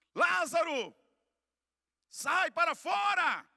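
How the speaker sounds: high, loud cry with drawn-out final words; commanding shout. Retold or imitated. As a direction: call the dead man out at full voice. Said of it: "ele dá um grito".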